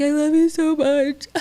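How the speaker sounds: high, loud blubbering declaration of love; mock ugly crying. Daughter mock-sobbing love to her mom, high and loud. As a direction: wail it like a hammed-up sob. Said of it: "like, ugly crying with each other".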